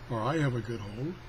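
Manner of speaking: ordinary delivery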